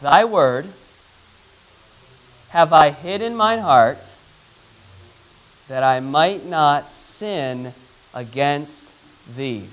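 verse in short, spaced phrases; measured recitation, fading at the end; slow group recitation. Shown as a demonstration. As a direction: lead a class reciting, pausing between phrases. Leads class reciting memory verse.

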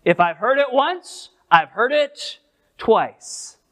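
ONCE and TWICE lifted high, TWICE held back and drawn out; emphatic restatement. Shown as a demonstration. Preacher explains a verse's repetition to the class, emphatic on ONCE and TWICE. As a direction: stress ONCE, then pause and land hard on TWICE. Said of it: "he's emphasizing this".

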